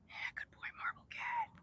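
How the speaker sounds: soft whispered praise to a cat; hushed whisper to a purring cat. Real, not acted. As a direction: breathe it out gently so he stays calm.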